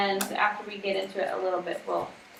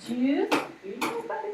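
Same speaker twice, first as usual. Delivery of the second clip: put-on little boy voice; 9 semitones higher than usual. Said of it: "He's like".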